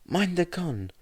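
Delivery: lifted, light voice for the warning; high character voice. A narrator's reading.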